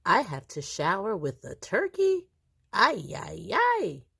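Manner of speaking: rising aghast wail on the last ay; dismayed. Acted. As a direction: groan it out in comic dismay. Grandpa dismayed sharing shower with turkey.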